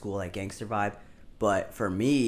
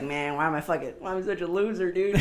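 higher-pitched mock lament over being a loser; self-pitying lament; 6 semitones higher than usual. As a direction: lightly whine like your younger self. Mocks own younger self's lament.

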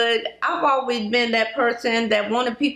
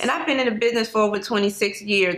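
ordinary talk

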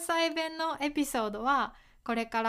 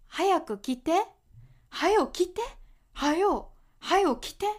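urging someone to hurry, higher and louder; impatient hurry-up; 7 semitones higher, 4 dB louder than usual. Shown as a demonstration. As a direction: nag them to come quickly. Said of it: "怖く聞こえるかな？".

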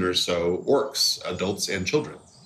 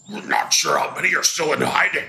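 laughing orc voice; quick stab at an orc; 6 semitones higher than usual. Acted. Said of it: "I'm missing a great opportunity to do an orc voice".

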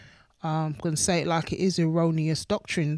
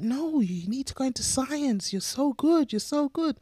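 mock-eager teacher voice, pitched high; teacher urging; 9 semitones higher than usual. Teacher pushing a pupil into science, high eager praise. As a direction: gush praise like a pushy teacher.

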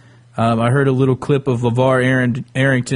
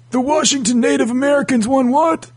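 put-on confused old man; 10 semitones higher than usual. Old fans in the future.

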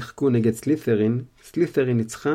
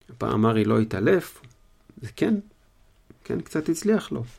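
ordinary delivery

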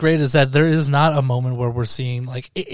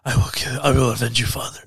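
lower, deliberate grown-man version; Skarsgård; 5 semitones lower than usual. Retold or imitated. Co-host quoting the adult version, low solemn vow. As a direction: drop it low for the grown avenger. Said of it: "use the fucking Skarsgård version".

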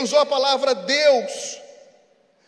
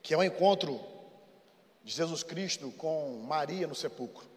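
narração suave; 7 semitones lower, 6 dB quieter than usual. Acted. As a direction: tell the tomb story gently, with long pauses. Soft story narration. (narração baixa e lenta)

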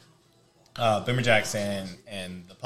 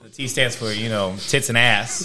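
louder hammed-up Boston bragging; Boston accent; 2 semitones higher, 5 dB louder than usual. Joking with friends about MIT, in a hammed-up Boston accent. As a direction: brag like a Boston guy who thinks he's wicked smart.

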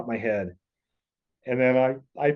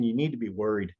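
ordinary delivery

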